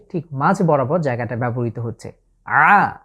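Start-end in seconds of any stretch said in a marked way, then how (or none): none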